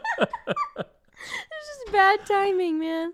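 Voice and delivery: high, very slow, squeezed by laughter; crying with laughter. Real, not acted. Explaining the choking to co-host, still laughing to tears. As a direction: wail it weakly through real laughter. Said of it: "I am crying".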